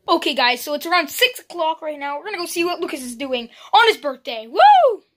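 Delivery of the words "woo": loud high whoop; excited. Real, not acted. Vlogger cheers loudly into the camera to end a segment. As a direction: whoop it out big.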